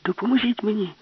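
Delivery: very high insistent plea; urgent plea. A narrator's reading. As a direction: beg again, way up high. Alice begs again. (відчайдушне благання дуже високо)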